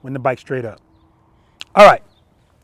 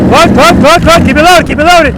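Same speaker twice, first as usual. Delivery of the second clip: loud, urgent repeated shout; 9 semitones higher, 9 dB louder than usual. Real, not acted. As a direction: yell it fast before they stall. Urgent calls to a stalling rider.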